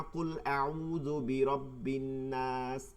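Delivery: slow, chanted reading of কুল আউযু বিরাব্বিন নাস; Quranic recitation. Shown as a demonstration. Teacher recites the verse from Surah Nas, slow and chanted. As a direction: recite it like in prayer. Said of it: "সূরা নাসের মধ্যে পড়ি না".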